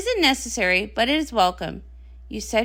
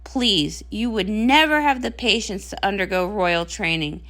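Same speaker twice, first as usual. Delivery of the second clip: usual speaking voice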